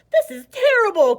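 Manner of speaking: high, loud cry of dismay. A narrator's reading. Snake crying out in horror at his body. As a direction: wail it in alarm. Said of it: "cried Verdi".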